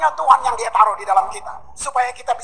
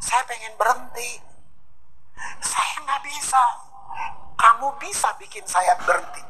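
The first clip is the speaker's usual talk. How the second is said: tearful prayer; 6 dB quieter, slower than usual. Pretend. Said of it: "Doanya seperti yang saya bilang tadi".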